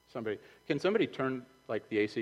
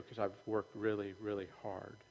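sheepish quiet; 3 semitones lower, 10 dB quieter than usual. Retold or imitated. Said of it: "You're gonna go".

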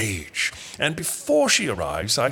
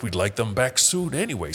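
normal talking voice

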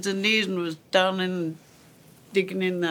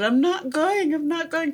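put-on stubborn little girl; 10 semitones higher than usual. Little sister refusing to go to school.

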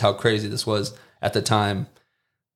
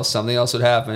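ordinary talk, nothing marked

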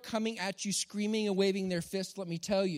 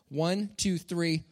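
ordinary delivery

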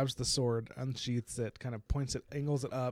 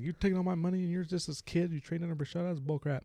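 sore loser; 7 semitones higher than usual. Retold or imitated. Sore loser accuses Davian.

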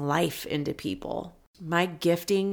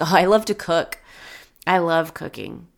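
lit up; 5 dB louder than usual. Retold or imitated. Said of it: "his whole countenance changed and he lit up".

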